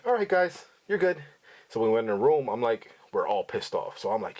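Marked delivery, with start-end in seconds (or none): 0.1-1.2 smug, high-pitched all-clear; a guard smirking as he gives the all-clear. Retold or imitated. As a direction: say it with a knowing smirk. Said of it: "he's smirking".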